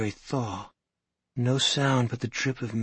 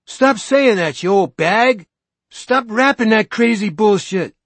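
much higher, louder furious shout; angry yelling; 11 semitones higher, 11 dB louder than usual. Acted. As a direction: shout her down. Teenager shoves old woman.

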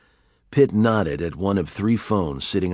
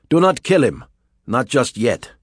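commanding order; 5 semitones higher, 5 dB louder than usual. Acted. Villain stopping his men.

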